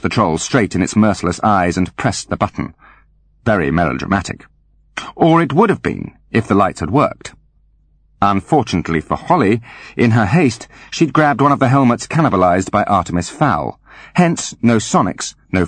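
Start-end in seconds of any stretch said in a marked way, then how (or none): none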